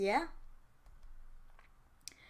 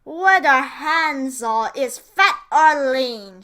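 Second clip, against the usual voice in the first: witch voice; 2 semitones higher, 11 dB louder, slower than usual. A narrator's reading. Witch announcing she will cook Hansel.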